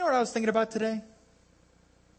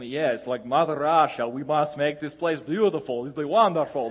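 a light put-on Russian accent, creepy; lightly voiced creepy Russian urging; mock Russian accent; 3 semitones lower than usual. Preacher mocking Red Scare fears, a lightly voiced creepy Russian accent. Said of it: "creepy guy with a Russian accent".

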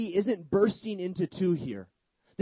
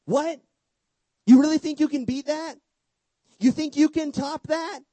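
much higher and louder, in disbelief; incredulous outburst; 8 semitones higher, 7 dB louder than usual. Real, not acted. Preacher to his congregation, loud incredulous disbelief. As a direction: throw it back at them, amazed they'd try.

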